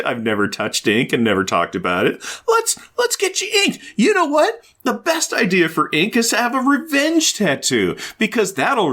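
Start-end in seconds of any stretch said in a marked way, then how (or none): 2.5-6.5 mock squealing enthusiasm. Friend urging tattoo, mock.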